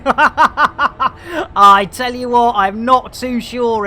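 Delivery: slow words through laughter; laughing. Real, not acted. Pilot laughs at another docking failure, slow and laughing. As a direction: laugh out the line.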